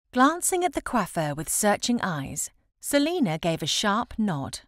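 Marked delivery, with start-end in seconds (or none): none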